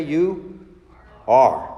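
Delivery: "are" hit hard and loud after a pause; slow, weighty "You... ARE"; stress on ARE. Shown as a demonstration. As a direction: land heavily on "are". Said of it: "What does he say?".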